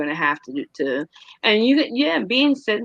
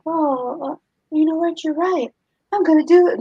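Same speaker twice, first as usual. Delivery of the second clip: high, chirpy sarcastic agreement; mock grateful reply; 10 semitones higher than usual. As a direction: squeak out a fake happy yes. Mocks unrealistic grateful reply.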